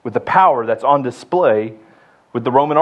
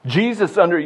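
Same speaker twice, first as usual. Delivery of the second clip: ordinary talk, nothing marked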